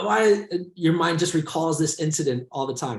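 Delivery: usual speaking voice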